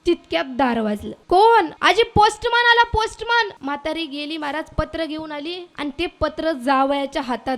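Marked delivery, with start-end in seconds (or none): 1.3-1.7 sharp loud call of who's there. Acted. Old woman calls out loudly at knock. 1.8-3.5 high, loud call at the door; postman calling. Acted. Postman calls granny from outside, loudly.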